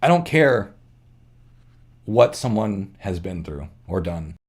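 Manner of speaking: fighting back tears while speaking; choked up. Real, not acted. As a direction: voice tight with real feeling, pausing to steady it. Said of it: "I'm getting choked up".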